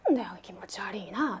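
creeped-out whisper under the breath; whispered unease. Retold or imitated. Aさん muttering about the woman in the lift, whispered. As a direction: hiss it so she won't hear.

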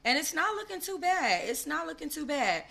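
impressed. Real, not acted. Admiring the post-baby body.

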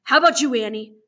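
sharp roll-call challenge; stern questioning. Acted. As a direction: fire the question at the next child. Warden demands each child's answer.

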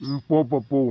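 loud gruff send-off; loud shooing. Acted. Father shooing his son out to play, loud. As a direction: bark at the boy to leave. Husband shoos son away.

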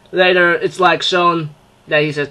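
usual speaking voice

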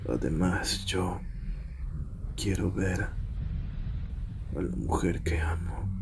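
soft, low, halting longing; hushed, lovesick admission; tender confession. Acted. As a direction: confide it quietly, almost to yourself. Confiding longing for his beloved.

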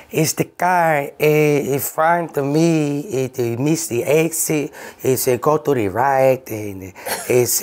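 high, slow broken-English driver voice; mock driver accent. Gloria the Uber driver excusing herself, slow put-on accent. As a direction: stall and fumble like a lost driver.

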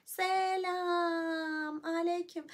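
very slow, stretched selam aleyküm; drawn-out greeting. Pretend. Greeting a viewer with a long, stretched selam aleyküm.

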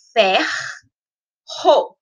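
"ferro" very slow, split with a pause: fer... ro; R separado do R. Shown as a demonstration. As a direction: hold "fer", pause, then "ro". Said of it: "separou o R do R". ("ferro" silabado, com pausa entre os erres)

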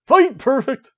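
loud, high arcade announcer shout; booming fighting game announcer. Acted. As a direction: call the win like an arcade announcer.

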